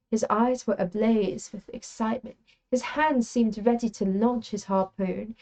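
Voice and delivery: put-on British accent for story reading. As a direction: read it aloud as if British. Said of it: "for some British behavior".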